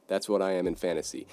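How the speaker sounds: ordinary talk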